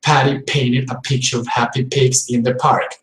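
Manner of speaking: strong aspirated P on Patty painted pigs; explosive English P. Shown as a demonstration. Teacher showing English learners explosive P. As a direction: puff air on every P in Patty. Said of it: "especially when the P is at the beginning".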